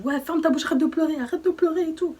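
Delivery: imitating an aggressive boyfriend shouting; retold yelling. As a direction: throw his angry commands back at her. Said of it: "il était là en train de me crier dessus".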